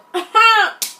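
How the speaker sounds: loud, high mock laugh; re-enacted laugh. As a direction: burst into a put-on cackle of disbelief. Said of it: "I'm laughing".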